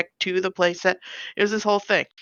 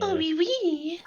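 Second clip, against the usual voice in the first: high mock French oui oui; a playful French accent, much higher; 11 semitones higher, 4 dB quieter than usual. Riffing on the French hamster character, mock French accent. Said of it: "the very French one".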